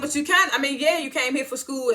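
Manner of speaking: high, loud, pushing back; high, loud heated rebuttal. Real, not acted. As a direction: cut in to argue the point. Arguing back at Jocelyn.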